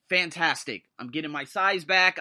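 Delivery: ordinary delivery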